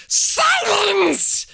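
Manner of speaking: very high, loud yell. A narrator's reading. Uncle yelling the boy into silence. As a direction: bellow for silence. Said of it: "yelled Uncle Vernon".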